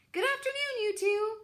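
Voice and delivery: put-on old goose Loudwing, high and chirpy. A narrator's reading. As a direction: squawk a cheery hello like an old goose.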